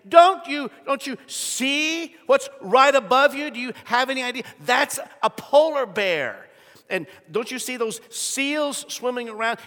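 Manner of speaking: incredulous father, pointing up at the bears; exasperated dad. Retold or imitated. Dad pointing his kids to the polar bear, exasperated.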